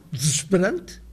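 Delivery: desesperante squeezed to one vowel, dzʃpeɾɐnt; only the an sounds in desesperante; one syllable. Shown as a demonstration. Lecturer shows the word has one syllable. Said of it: "se nós pronunciamos só o an".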